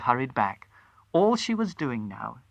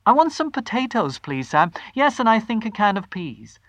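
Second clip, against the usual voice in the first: high, bright and eager; rehearsed cheer; 7 semitones higher than usual. A narrator's reading. Wife rehearsing her shopping order, forced bright cheer. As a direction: practise sounding casually happy. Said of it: "the voice were coming out better".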